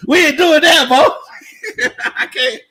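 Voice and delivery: high, loud laughing refusal; laughing loud protest. Real, not acted. Laughing loudly at a chat joke about getting naked.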